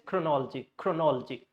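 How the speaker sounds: chronology as kro-NOL-jee; chronology squeezed, no O after the L; stressed NOL in chronology. Shown as a demonstration. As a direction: make "nol" clear in chronology. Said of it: "So you can say".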